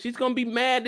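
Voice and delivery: normal talking voice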